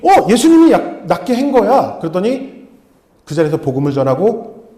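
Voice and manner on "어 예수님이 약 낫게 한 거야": much higher and louder, bursting with joy; excited. Retold or imitated. Missionary telling the healed man Jesus did it, high and loud. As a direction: announce it like a miracle just happened.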